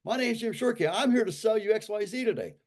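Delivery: high, loud cheery door-to-door pitch; salesman pitch. Retold or imitated. Salesman greeting a stranger at the door, bright and high. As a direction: chirp it like an eager salesman.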